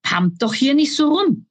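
grouchy goblin comeback; grumbling retort. A narrator's reading. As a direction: gripe back at them. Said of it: "motzte Balduin zurück".

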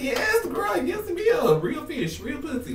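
squealing retold delight at the cute packaging; excited squeal. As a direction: cheer it on like a hyped best friend. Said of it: "I was like".

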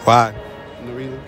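normal talking voice

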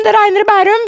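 mother mimics grandma hurrying in from the rain; imitating grandmother. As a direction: play a fussing grandma calling up from the garden. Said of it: "Mormor sier".

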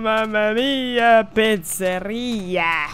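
1 mock Italian. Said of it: "mock Italian reading of name".

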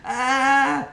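high, loud wimpy squat cry; mock whiny groan. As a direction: whimper loudly like a wimp mid-squat. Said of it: "hating it like a little bitch".

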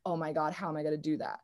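a quick worried mutter; mock panic under the breath; mock dread. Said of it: "And you're like".

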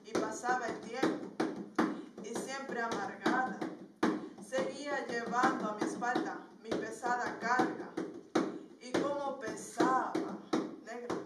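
dramatic spoken-word poem with rhythmic refrains; projected, deliberately paced verse; poem recitation. Acted. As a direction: recite a burdened memory, broken by chanted taunts.

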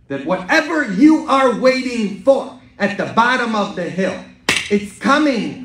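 loud, high preacher's proclamation; full-force preaching. Acted. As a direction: proclaim the hope to the whole congregation.

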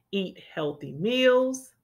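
ordinary delivery

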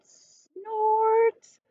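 a big, high, honking snort; loud snort. Acted. The big thing answers the baby bird, loud honking snort. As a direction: blast it like a machine's horn.

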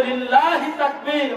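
"লিল্লাহি" slow, with a long stretched "la"; corrected slogan. Shown as a demonstration. Preacher shows what must be said, "লিল্লাহি" stretched long. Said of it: "বলতে হবে".

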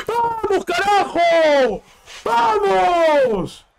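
screaming goal, high and strained; goal celebration shout. Real, not acted. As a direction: yell it at the top of your lungs.

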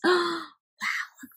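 ordinary delivery, nothing marked

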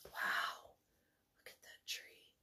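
soft, whispered wonder; hushed aside. Real, not acted. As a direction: whisper in awe at the picture.

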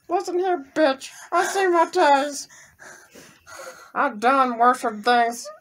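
high, slow put-on teddy bear voice. Husband voices the teddy bear taunting his wife, high and slow. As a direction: speak as the doomed toy, drawn out and high.

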